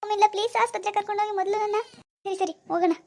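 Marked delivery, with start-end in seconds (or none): 0.0-1.8 high, strained voice crying out in pain; moaning in pain, pleading with her mother-in-law. Acted. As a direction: beg for help through stomach cramps. Acted stomach pain, pleading.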